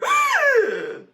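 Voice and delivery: huge, high, loud gasp; old school movie gasp. Retold or imitated. Brother hears his name from a stranger, loud dramatic gasp. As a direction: gasp in exaggerated shock like an old film. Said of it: "old school movie gasp".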